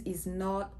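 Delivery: usual speaking voice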